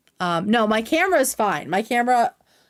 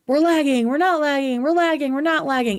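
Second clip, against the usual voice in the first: higher, sing-song mock of the complaints; exasperated higher mimicry of viewers; mocking chat; 6 semitones higher than usual. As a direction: parrot the back-and-forth in a fed-up mock voice. Mocking chat's lag complaints.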